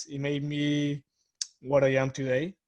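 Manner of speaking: ordinary talk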